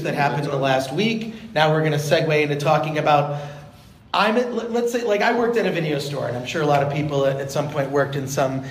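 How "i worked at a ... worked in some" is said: ordinary delivery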